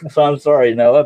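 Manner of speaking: high, loud, through laughter; amused, laughter spilling into the words; laughing apology. Real, not acted. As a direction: apologize while still laughing. Laughing apology after accent teasing.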